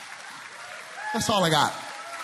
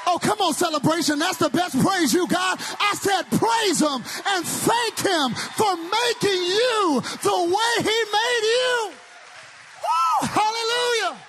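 loud, high, sung-out preacher cadence; full-force preaching; 7 semitones higher, 3 dB louder than usual. Acted.

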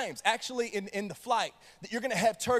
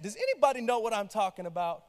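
ordinary talk

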